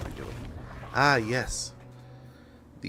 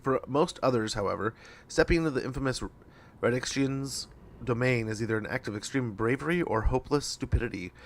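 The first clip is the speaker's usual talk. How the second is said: dramatic reading; 4 semitones higher than usual. Acted. Reads mission briefing aloud.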